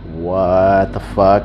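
slow, low "what the fuck"; deliberate, dawning realisation; stunned disbelief. Retold or imitated. Acts stopping in disbelief.

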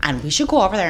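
fast, rushed rallying talk; fast rant. Real, not acted. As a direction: rush it out.

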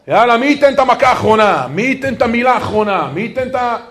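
loud, high-pitched challenge; combative goading. Acted. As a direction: act out the urge for the last word. Mimics our combative instinct.

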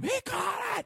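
very high-pitched excited mock scream; retold scream. Fan reliving the catch for podcast hosts, imitated scream. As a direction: squeal it high, without full volume. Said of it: "I jump up and scream".

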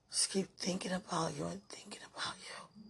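faint whisper of longing. Real, not acted. Whispering her obsession with sweetheart in a voice note. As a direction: whisper it, almost gone. Lovesick voice message.

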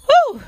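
high, loud whew; exasperated "whew". Real, not acted. Exhausted outburst while retelling.

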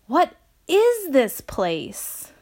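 high, slow, loud call. A narrator's reading. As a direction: shout a bewildered question across a distance. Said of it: "Walter called out".

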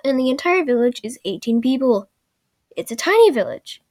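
usual speaking voice

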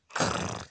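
animal growl; rolled, throaty "krrr". Acted. Tiger girl refusing to go along, growling.

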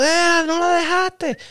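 high, stretched-out mock holler; mock yell. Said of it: "no me gritó". (grito fingido, agudo y alargado)

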